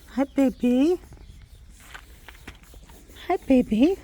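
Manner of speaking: high cooing voice. Real, not acted. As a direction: coo it sweetly upward. High coo to foal.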